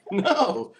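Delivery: put-on high baby-talk voice with a laugh. Imitating baby talk to a child.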